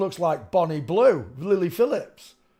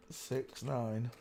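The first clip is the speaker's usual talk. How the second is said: ordinary talk, nothing marked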